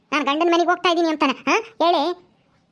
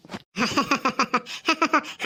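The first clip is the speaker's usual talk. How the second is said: laugh; 4 semitones lower, 6 dB quieter than usual. Acted. Laughs at dreamer.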